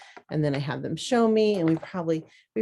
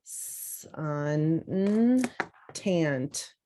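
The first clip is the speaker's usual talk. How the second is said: slow dictation; much slower than usual. Shown as a demonstration. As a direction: dictate each syllable slowly while writing. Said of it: "going to write sun".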